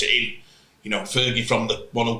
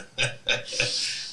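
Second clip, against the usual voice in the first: a breathless, voiceless laugh under the breath; silent wheezy laugh; 9 dB quieter than usual. Retold or imitated. Coach laughing at the player, silent breathy laugh. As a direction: laugh with no voice at all, just air. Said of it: "Bastian looked at me and went".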